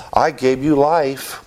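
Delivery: proud boast put in a father's mouth; mock arrogant father. Preacher mocking a father's boast to his child, slow and lordly. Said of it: "fathers sometimes say to their children".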